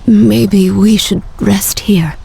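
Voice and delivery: slow, frozen, breathy whisper; weak voice of someone freezing. Acted. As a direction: barely get the words out through the cold. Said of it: "I'm so cold".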